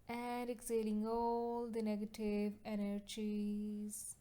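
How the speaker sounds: slow, drawn-out meditation voice; guided meditation. Acted. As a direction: lead a relaxation with long, lingering vowels. Leads breathing and relaxation.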